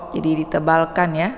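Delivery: usual speaking voice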